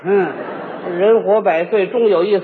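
low, solemn last words; mock resignation. Reluctant performer facing a somersault as if execution, mock resigned. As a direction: intone it like a doomed man, low and grave. Steeling himself for somersaults as if for death.

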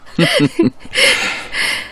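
high, breathy laugh falling away; self-amused laugh that trails off; hearty laugh. Acted. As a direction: laugh at your own joke. Laughs at himself.

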